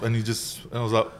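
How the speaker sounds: ordinary talk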